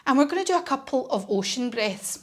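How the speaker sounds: ordinary talk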